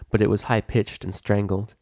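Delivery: ordinary delivery, nothing marked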